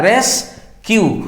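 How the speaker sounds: रेस्क्यू slowly, in two beats RES and KYU; two syllables. Shown as a demonstration. Teacher confirming it is disyllabic, रेस्क्यू slowly in two parts. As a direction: divide रेस्क्यू after RES, taking time. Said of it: "ये डाइसिलेबिक है".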